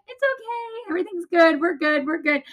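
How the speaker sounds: high-pitched singsong, imitating fake cheer; forced singsong reassurance. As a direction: act out soothing a child while hiding panic. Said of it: "singing a song as I'm crying and trying to keep her calm".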